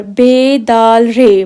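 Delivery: बे दाल रे spelt out very slowly, each letter apart; slow letter spelling. Shown as a demonstration. Teacher spelling बदर for learners, very slow. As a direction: drag out each letter name. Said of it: "For example बदर".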